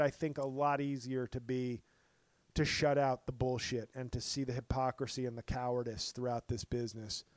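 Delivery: normal talking voice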